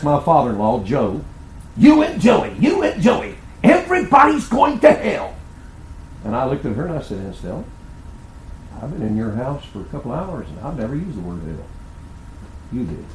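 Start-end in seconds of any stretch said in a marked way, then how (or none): 1.8-5.3 high, shrill-free outburst, mocking the angry aunt. Aunt shaking a dish towel at the preacher, high mock outburst. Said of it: "she's shaking that dish towel at me". 7.2-13.0 calm rebuke. Retold or imitated. Preacher quietly rebuking the shouting aunt, soft and steady.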